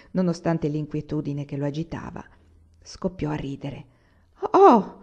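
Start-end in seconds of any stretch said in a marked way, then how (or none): 4.5-5.0 high, loud cry of discovery; shouted exclamation. A narrator's reading. Agata spotting the listener behind the wall, shouting. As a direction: call out sharply over the wall. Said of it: "gridò Agata".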